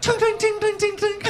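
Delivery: coin sound. Retold or imitated. Imitating coins on the counter.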